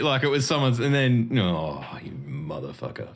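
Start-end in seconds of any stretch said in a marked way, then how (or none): 1.4-3.1 dawning realization. Retold or imitated. Quotes his dawning realization.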